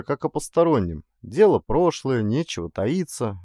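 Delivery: ordinary delivery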